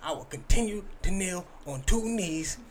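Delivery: impassioned preacher impression, halting phrases; Ray Lewis. Comic quoting a kneeling athlete's vow, fervent and theatrical. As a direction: build from hushed to high, righteous testimony. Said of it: "This is the sort of what he said".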